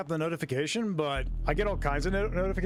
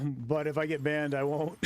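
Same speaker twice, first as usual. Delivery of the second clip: ordinary delivery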